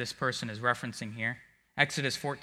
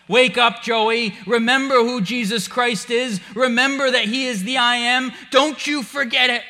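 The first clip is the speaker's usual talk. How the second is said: high, loud self-rebuke; yelling at himself; 9 semitones higher, 9 dB louder than usual. Retold or imitated. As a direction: shout yourself awake. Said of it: "I yell at myself".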